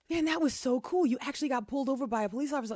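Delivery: put-on high, thrilled kid's voice; excited kid. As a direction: gush like an impressed child. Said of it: "because Gabby said".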